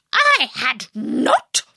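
slow, stressed angry retort; sharp and angry. A narrator's reading. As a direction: snap it back, offended. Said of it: "cried the Mouse sharply and very angrily".

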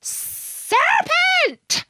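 very high, screeching bird cry; screamed. A narrator's reading. Pigeon attacking Alice as a snake, screaming. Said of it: "screamed the pigeon".

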